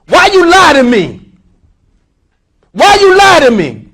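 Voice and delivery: loud, high accusing shout; angry boss. Acted. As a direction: confront the lying worker. Acted boss angry at lie.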